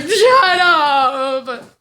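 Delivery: loud, drawn-out mock-crying retort; tearful protest. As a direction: wail it at the teasing guys. Snaps tearfully at the guys.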